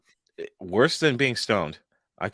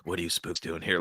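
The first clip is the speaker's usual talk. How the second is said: low, soft tough-guy question; Clint Eastwood; 5 semitones lower, 8 dB quieter than usual. Acted. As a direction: drop low and quiet, menacing. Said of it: "like Clint Eastwood out of Gran Torino".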